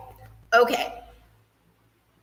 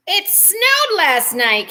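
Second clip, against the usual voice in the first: amazed; 7 semitones higher, slower than usual. Real, not acted. Amazed news of April snow.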